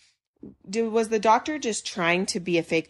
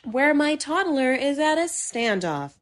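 higher, slow news anchor voice; 7 semitones higher than usual. Retold or imitated. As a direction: report it like a newscaster. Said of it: "the best news voice".

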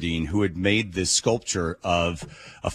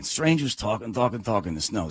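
normal talking voice

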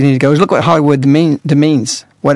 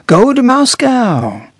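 slow, higher quoted line; quoting the bishops; 7 semitones higher, much slower than usual. Retold or imitated. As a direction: recite it like a taught phrase. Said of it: "And they said".

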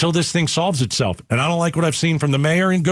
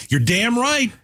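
higher, heated radio rant; raised-pitch indignation; real anger; 7 semitones higher, slower than usual. Real, not acted. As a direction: fire back at the caller. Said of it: "I'm yelling and I'm angry".

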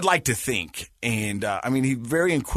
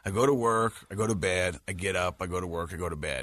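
fading softer, lower drone; weary routine; 7 semitones lower, 6 dB quieter than usual. Retold or imitated. Dad describing the mundane grind, fading weary drone. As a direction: let each phrase sink lower and softer. Mocking routine of jaded life.

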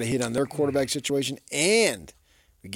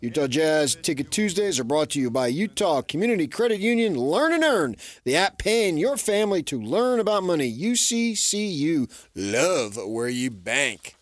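upbeat, higher promo read; radio contest promo; 5 semitones higher than usual. Acted. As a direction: sell the giveaway like a radio ad. Host reads contest promo.

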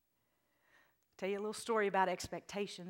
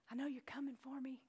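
quiet trusting prayer; soft prayer; 3 semitones higher, 10 dB quieter than usual. Acted. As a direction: say it softly as a prayer. Prayer-like quote.

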